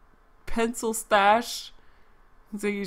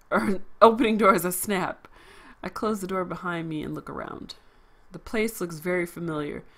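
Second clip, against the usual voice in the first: ordinary talk, nothing marked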